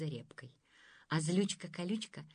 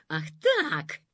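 loud angry snap; angered; 3 semitones lower, 9 dB louder than usual. A narrator's reading. Thorn-lady angered at Dunka's defiance. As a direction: bristle with sudden anger. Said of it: "обозлилась злючка".

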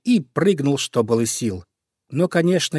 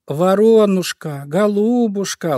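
higher, louder, slow coaxing fox voice; sweet-talking fox; 8 semitones higher, 4 dB louder, slower than usual. Acted. Fox flattering the crow into landing, coaxing sweetly. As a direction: sweet-talk the crow slowly, all honey. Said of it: "ласковым голосом начала она".

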